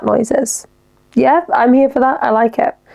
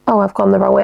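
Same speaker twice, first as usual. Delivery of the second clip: ordinary talk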